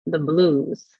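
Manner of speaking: plain reading voice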